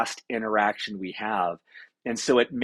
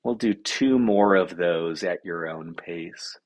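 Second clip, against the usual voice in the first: slow, low meditation voice; slow, settled breathing instruction; guided meditation; 4 semitones lower than usual. Acted. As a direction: guide the breathing slowly, in a lowered pitch.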